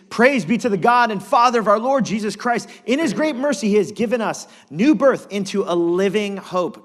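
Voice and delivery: loud, high scripture proclamation; fiery pulpit reading; preaching at full force. Acted. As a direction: thunder the verse to the congregation.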